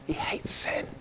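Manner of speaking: grave, hushed whisper. Real, not acted. Preacher whispering about God's wrath. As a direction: whisper it with weight.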